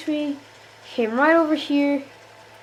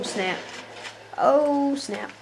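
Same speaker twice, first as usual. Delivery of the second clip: ordinary talk, nothing marked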